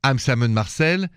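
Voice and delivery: put-on thick Parisian French accent on the greeting. Radio host greeting his listeners in a French accent. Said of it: "The accent is straight from Paris".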